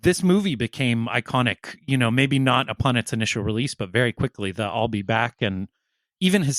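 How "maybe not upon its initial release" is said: usual speaking voice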